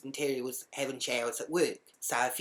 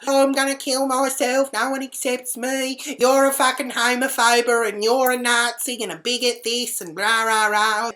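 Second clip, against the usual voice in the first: high, loud wailing tirade; mock hysterical rant; 8 semitones higher, 9 dB louder than usual. Imitating the housemate's outburst at being accused, mock hysterical rant. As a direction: mimic an over-dramatic meltdown. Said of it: "then he starts".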